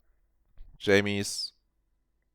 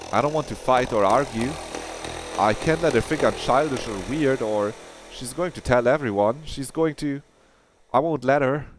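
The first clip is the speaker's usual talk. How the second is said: higher, quickening nervous self-talk; rising panic; 6 semitones higher than usual. Acted. Claire panicking about what Jamie will think, higher and anxious. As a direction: talk herself into lying, anxious.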